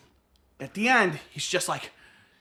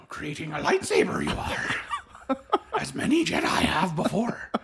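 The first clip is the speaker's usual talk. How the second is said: laughing whispered Yoda voice; Yoda impression; 4 dB quieter than usual. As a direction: rasp it like a tiny old Jedi master, half giggling. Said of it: "Yoda starts talking".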